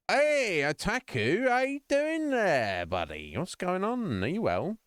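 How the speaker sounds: high, loud, drawn-out hello; excited greeting. Real, not acted. Streamer greets Otaku arriving in chat, excited and high. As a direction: sing out a big welcome, stretching the vowels high.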